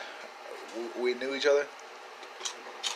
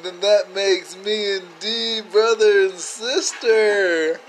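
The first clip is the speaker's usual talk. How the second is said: higher, slow, spelling out each step of the logic; a mock-dramatic slow reveal; slow dawning realisation; 5 semitones higher, much slower than usual. Slowly working out the family tie.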